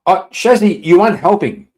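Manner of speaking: high, loud mock-scolding of a friend; exasperated shout. Real, not acted. Streamer scolds chatter Shazzy for egging Charles on, loud exasperated shout. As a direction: yell at her to stop making it worse.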